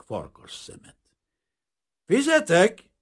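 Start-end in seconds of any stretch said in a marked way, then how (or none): 2.1-2.9 high, loud call across the room; shouted call. A narrator's reading. Grain dealer calling the waiter to pay, shouting. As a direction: call out sharply to be heard. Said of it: "kiáltott Aronson".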